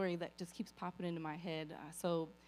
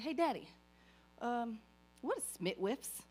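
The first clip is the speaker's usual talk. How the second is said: put-on higher, sweet child asking Daddy; little girl voice; 6 semitones higher than usual. Little daughter asking her dad what the pill box says, higher and sweet. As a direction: play her small self asking Daddy.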